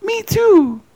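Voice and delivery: very high, mock English accent; nice English accent. Joking as the English woman interviewer, high nice English accent. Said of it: "in a real nice English accent".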